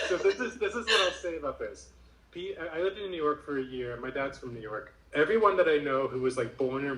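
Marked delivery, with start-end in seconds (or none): 0.0-1.9 higher voice through real laughter; laughing. Real, not acted. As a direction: start your answer still laughing. Laughing after listener says New Yorker.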